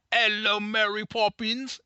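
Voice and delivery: high, slow, hammed-up bad Cockney accent. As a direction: sing out a terrible British accent, drawn out.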